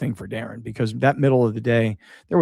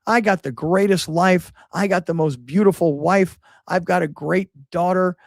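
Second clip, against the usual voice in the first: happy Billy Ray; 6 semitones higher than usual. Retold or imitated. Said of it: "He would go on and on".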